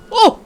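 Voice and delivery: short high "uh" cry; startled yelp. Real, not acted. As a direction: let out a sudden yelp. Sudden scare in the dark.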